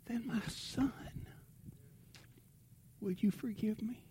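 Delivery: a father whispering his plea for forgiveness to his son; tender whisper. Retold or imitated. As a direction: whisper the apology gently. Father begging son's forgiveness.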